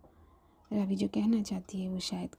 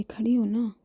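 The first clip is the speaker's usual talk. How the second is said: insisting; 2 semitones higher, 7 dB louder than usual. Acted. Raavi insists.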